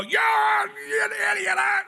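sharp mock outburst; mock insulting shout. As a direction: blurt the insult in a hammed-up rage. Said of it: "don't go".

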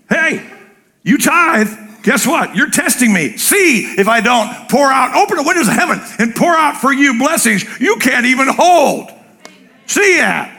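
high, lively, confident challenge; God daring the tither to test him; God speaking. Retold or imitated. God challenging tithers, playful and bold. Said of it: "God said".

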